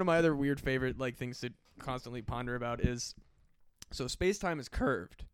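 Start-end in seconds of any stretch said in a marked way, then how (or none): 3.9-5.2 slow, voice breaks on "curved"; voice cracking. Real, not acted. Musing on space-time to friends, voice cracking on curved. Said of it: "My voice is cracked, like, I'm fucking thirteen".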